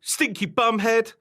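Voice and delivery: put-on high, loud childish taunt; shouted insult. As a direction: hurl it like playground name-calling. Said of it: "shouted Barbara".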